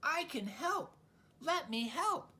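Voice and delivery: high, eager little squawk; a baby bird piping up to help; baby gull squawking to its mother. Acted.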